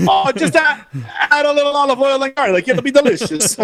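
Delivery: high, laughing fake Italian accent; hammed-up Italian accent through laughter; mock Italian accent. Co-host carries on the Italian joke, mock Italian accent. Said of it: "I can't do an Italian accent".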